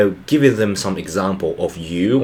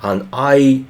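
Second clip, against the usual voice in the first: usual speaking voice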